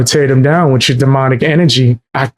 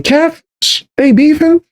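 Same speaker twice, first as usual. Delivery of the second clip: mock surprise; 13 semitones higher than usual. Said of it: "I'm like".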